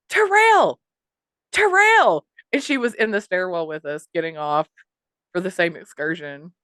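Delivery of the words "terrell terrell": two high, loud mock shouts; imitated holler. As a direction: holler the name so it echoes, imitating her. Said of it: "echoing throughout the stairwell, you hear".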